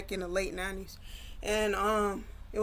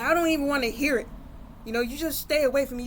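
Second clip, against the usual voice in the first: quick, loud, higher-pitched dismissal; angry rejection; 5 semitones higher, 4 dB louder, faster than usual. Retold or imitated. Brother refuses sister's apology, angry and dismissive. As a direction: cut her off fast and push her away.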